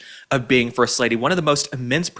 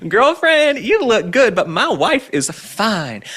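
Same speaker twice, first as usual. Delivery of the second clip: put-on sassy accent, high and loud, then drawn out; hammed-up new accent, starting high and slowing down; mock Barack Obama accent; 10 semitones higher, 5 dB louder, slower than usual. Host mocking Obama's new accent, sassy put-on accent. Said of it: "doing this new accent where he's like".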